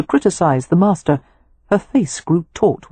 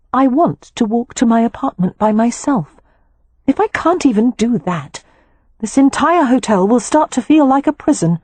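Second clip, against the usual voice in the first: much higher, rising frustration to the end; pleading young wife; 8 semitones higher than usual. Acted. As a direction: insist on freedom, voice climbing. Poppy insists firmly.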